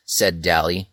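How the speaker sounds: plain reading voice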